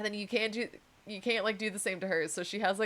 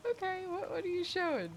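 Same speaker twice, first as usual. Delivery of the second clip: high curious voice; 12 semitones higher than usual. Retold or imitated. Imitating coworker's reply.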